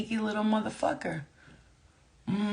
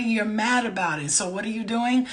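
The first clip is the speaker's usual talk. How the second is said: ordinary delivery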